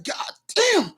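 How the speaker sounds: high, soft mock-crying curse; imitated crying. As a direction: curse as if starting to cry. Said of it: "like, crying".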